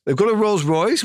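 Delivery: a high, rising question of mock surprise; surprised disbelief. Retelling producers' surprise at the Rolls Royce, rising high. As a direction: lift it up in disbelief at the luxury. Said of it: "Well, that's a big car".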